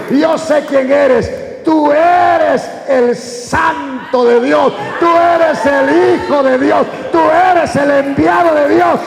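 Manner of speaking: loud, high, stretched-out proclamation; possessed man's full-voiced outcry; demon's shouting. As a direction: shout each title slowly as the demon. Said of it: "El demonio dijo".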